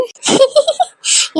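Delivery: high, gleeful burst of laughter; delighted laugh. Acted. Grandmother laughing at news of a grandson, delighted laugh.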